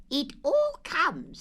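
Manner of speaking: high, stern Rabbit voice; a hint of a stern scolding. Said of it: "said Rabbit sternly".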